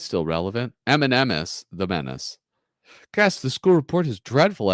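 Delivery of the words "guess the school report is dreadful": angry dad voice. Acted. Father reading report in comic.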